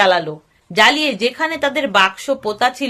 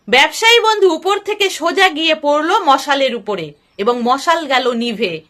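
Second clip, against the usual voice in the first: ordinary delivery, nothing marked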